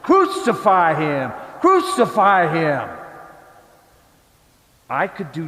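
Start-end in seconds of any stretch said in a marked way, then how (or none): 0.0-2.9 louder, insistent repeated cry; mob shout. Retold or imitated. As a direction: demand it like a baying crowd. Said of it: "everyone shouted".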